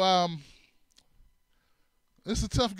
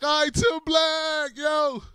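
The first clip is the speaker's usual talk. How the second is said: hearty greeting; 8 semitones higher, much slower than usual. Retold or imitated. Friend greeting, slow.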